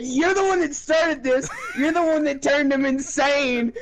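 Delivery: high, hammed-up accusation; mock accusing shout. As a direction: imitate a panicked player casting blame. Retold player blaming another player.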